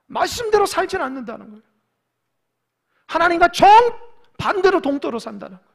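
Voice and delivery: loud, high, driving sermon voice; full-force preaching. Acted. Preacher exposing empty praise without faith, full-force preaching. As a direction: denounce it from the pulpit.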